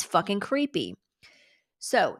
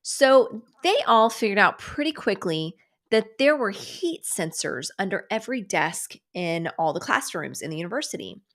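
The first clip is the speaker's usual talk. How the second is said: usual speaking voice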